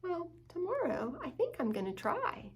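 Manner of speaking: lightly voiced determined pup; eager puppy. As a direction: say it like a shy brave pup. Hugo resolves.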